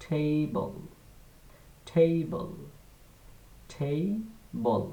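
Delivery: "টেবল" as "ঠেইবল", drawn out for repeating; "টেবল" as thei-bol, syllables stretched; aspirated ঠ. Shown as a demonstration. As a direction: model "টেবল" with a puff on T. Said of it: "আমার সাথে উচ্চারণ করার চেষ্টা করেন".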